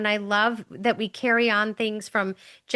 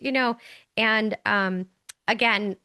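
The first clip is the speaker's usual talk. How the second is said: ordinary talk, nothing marked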